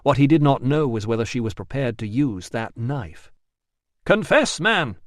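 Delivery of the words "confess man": high, loud yell. A narrator's reading. Rousseau yelling at the trapped man to confess. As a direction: shout it at the door. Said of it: "he yelled".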